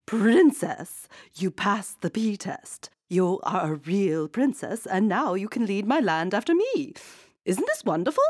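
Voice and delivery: queen exclaiming happily; excited queen. A narrator's reading. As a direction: exclaim it with delight. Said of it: "exclaimed the queen".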